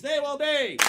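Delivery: loud, high catchphrase lead-in; announcer cue. Real, not acted. Host prompting audience to finish rule, announcer cue. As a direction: belt it out to cue a crowd.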